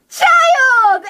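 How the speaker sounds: loud, high squeal of joy; delighted cry. Acted. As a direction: burst out at a high pitch, overjoyed. Cat suddenly delighted to see child.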